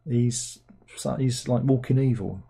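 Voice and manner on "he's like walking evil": ordinary delivery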